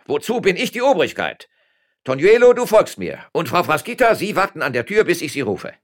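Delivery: loud, high, pompous mayor; conceited commands. A narrator's reading. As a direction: order everyone about self-importantly. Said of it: "erklärte der eingebildete Bürgermeister".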